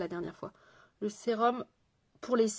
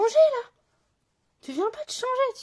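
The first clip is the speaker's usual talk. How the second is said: surprised exclaim; 8 dB louder than usual. Real, not acted. Noticing viewer changed hair colour.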